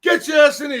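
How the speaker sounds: retold angry shout to Joey. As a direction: bellow the order across the room.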